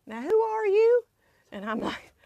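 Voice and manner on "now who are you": imitated grandfather, voice climbing high. Grandfather asking the same question over, imitated in a high voice. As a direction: ask like a forgetful grandpa, swooping up. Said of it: "And then he'd go".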